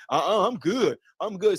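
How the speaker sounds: imitating people pretending they're fine; mock self-assurance. Speaker mimics viewers pretending all is well, mock self-assured. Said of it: "pretend that y'all".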